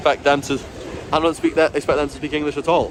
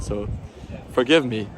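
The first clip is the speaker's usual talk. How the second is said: ordinary talk, nothing marked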